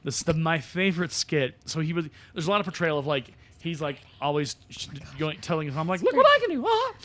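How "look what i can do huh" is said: put-on very high, loud childish man-child voice; Stuart voice. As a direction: brag like an overgrown kid. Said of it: "it really is like that voice".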